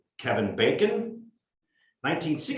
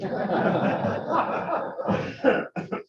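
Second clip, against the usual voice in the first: laughing; 10 semitones higher than usual. Real, not acted. Breaks up after the fake ad.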